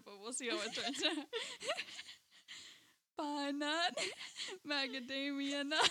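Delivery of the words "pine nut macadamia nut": bad southern accent, raised pitch, laughing; weak Southern drawl through giggles. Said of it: "I cannot do a southern accent".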